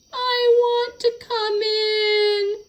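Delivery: very high, slow, crying yowl; yowling cat. Acted. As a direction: wail it like a wet, lonely cat at the door. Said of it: "he yowled".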